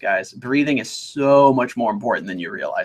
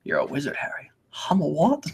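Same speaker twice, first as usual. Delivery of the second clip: hushed film quote; 6 dB quieter than usual. Acted. Quoting Harry Potter parody scene.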